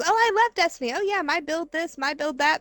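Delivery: high-pitched mock gushing; gushing panelists. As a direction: imitate eager gamers bragging in a high voice. Acting gushing fellow panelists.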